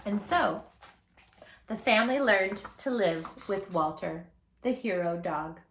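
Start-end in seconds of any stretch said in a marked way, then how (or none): none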